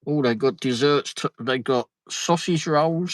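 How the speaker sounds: ordinary talk, nothing marked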